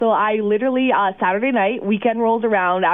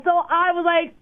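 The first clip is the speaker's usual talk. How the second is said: usual speaking voice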